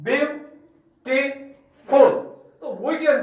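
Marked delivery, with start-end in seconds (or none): 0.1-1.3 ब्यूटीफुल split into three slow, separate beats; teacher counting out the three syllables of ब्यूटीफुल. Shown as a demonstration. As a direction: pause fully between byu, ti and ful in ब्यूटीफुल. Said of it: "तीन बार चढ़ गया".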